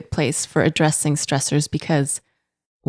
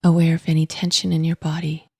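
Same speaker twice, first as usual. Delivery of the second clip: calm, unhurried meditation voice with long pauses; guided meditation. Acted. Podcast host guiding listeners to notice breath and body, calm meditation voice. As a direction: guide gently, in even, spacious phrases.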